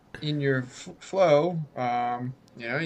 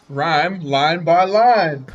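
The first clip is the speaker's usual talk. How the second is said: slow loud declaration; 2 semitones higher, 8 dB louder, slower than usual. Shown as a demonstration. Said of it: "For the rest of rhyme time".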